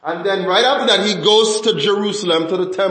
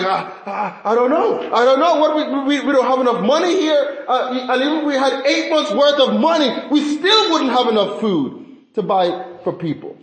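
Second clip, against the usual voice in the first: high, stammering mock panic; panicking Philip; 6 semitones higher than usual. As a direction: tumble over the words, pitched up and flustered. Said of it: "He's panicking".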